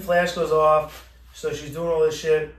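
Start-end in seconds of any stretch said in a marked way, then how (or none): none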